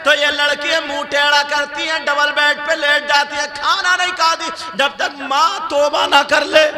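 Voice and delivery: high, loud chanting sermon delivery; high-pitched chanted exhortation; full-force preaching. Acted. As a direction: preach it loudly on a high sustained tone.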